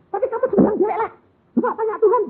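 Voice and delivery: put-on high, fast girlish pleading; young woman. Fiance urges bride to ask God, high, fast. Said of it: "dia bilang".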